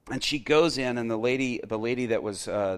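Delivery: ordinary delivery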